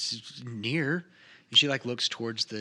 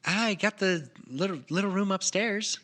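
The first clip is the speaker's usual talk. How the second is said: NPC voice; 9 semitones higher, 3 dB louder than usual. Acted. GM voicing a shy NPC.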